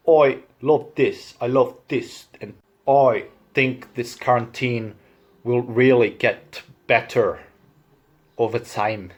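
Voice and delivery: Irish demo, "oi" vowels and hard th; measured Irish lilt; Irish accent. Shown as a demonstration. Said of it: "Irish people also, uh, pronounce vowels differently".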